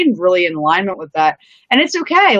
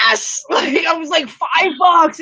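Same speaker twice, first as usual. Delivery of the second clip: excited cheer; 7 semitones higher, slower than usual. Retold or imitated. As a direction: shout it with joy, pitch up. Excited over finding five dollars.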